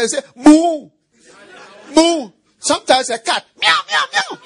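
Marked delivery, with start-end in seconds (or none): 0.4-2.3 loud mooing like a cow; playful cow moo. Pretend. Preacher imitating animal sounds. 3.6-4.4 high squeaky meowing; playful high cat meows. Pretend. Imitating cat sounds.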